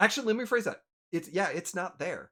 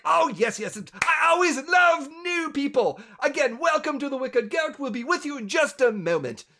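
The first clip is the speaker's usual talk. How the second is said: loud, high, gushing enthusiasm; happy, energetic innkeeper; 8 semitones higher, 6 dB louder than usual. Acted. Innkeeper gushing to new people, loud and excited. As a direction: burst out with delight at the guests. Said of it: "full-on happy person".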